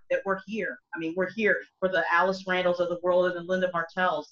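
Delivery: ordinary talk, nothing marked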